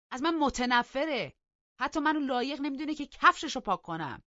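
high, loud, incredulous outburst; loud scornful protest, pitched high; indignant shout. A narrator's reading. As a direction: shout it back in wounded disbelief. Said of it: "داد زد".

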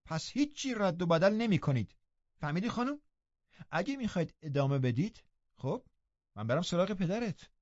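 lightly voiced stern nurse; firm warning. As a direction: lay down the law to the girl. Nelly lays down terms.

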